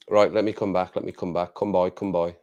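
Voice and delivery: ordinary talk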